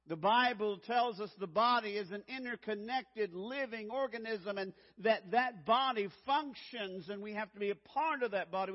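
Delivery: loud, driving sermon delivery; full-force pulpit preaching; fervent preaching. Acted. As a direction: preach it hard to the congregation.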